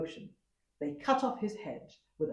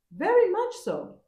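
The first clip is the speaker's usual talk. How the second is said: much higher, louder servant voice; eager servants insisting; high, bright-pitched servant reply; 8 dB louder than usual. A narrator's reading. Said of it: "replied the servants".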